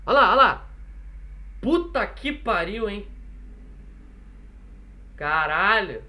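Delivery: loud, drawn-out swearing; real loud exasperated outburst; loud exasperation. Real, not acted. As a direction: curse the racket out loud, dragging the words. Swearing at noisy street outside.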